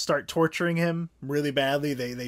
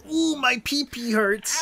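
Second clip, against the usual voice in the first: whiny mock pain; 9 semitones higher, 4 dB louder, slower than usual. Mocking Clint in pain.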